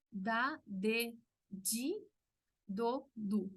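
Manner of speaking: da, de, di with di said like "dji"; Brazilian Portuguese syllables. Shown as a demonstration. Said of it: "En portugués". (di como "dyi" en portugués brasileño)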